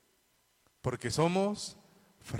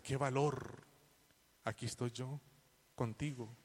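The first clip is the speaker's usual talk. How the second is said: softer, hushed, comforting; tender reassurance; 6 dB quieter than usual. Acted. Preacher speaking softly as God comforting each listener. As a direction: murmur it like soothing a frightened child.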